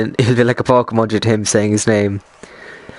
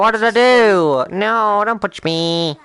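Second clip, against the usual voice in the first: high, drawn-out mock wail; hammed-up helpless protest; mock pleading; 7 semitones higher, slower than usual. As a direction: plead slowly, stretching the words in pretend distress. Mock plea against being punched.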